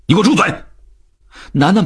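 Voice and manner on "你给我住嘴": high-pitched burst of anger; sudden furious yell, pitch shooting up; angry roar. Acted. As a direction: roar at your son to silence him. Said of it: "冲着楠楠吼道".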